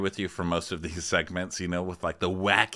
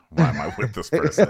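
loud laughing despair; exasperated laughing wife; laughing exasperation; 6 semitones higher, 6 dB louder than usual. Retold or imitated. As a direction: laugh it out in disbelief. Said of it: "She's just like".

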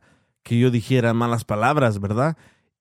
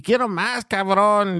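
put-on high kid voice demanding more; mimicking a kid; 6 semitones higher, slower than usual. Retelling a kid's beer video, high childish voice. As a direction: play the tipsy little boy. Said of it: "dice el niño".

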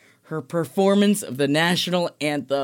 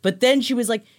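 ordinary delivery

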